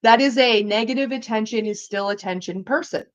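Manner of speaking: normal talking voice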